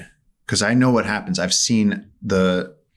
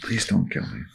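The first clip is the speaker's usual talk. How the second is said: small, soft mock pleading; hushed, imitated begging; meek plea; 6 dB quieter than usual. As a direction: plead quietly, as the helpless rider. Retold plea of cornered rider.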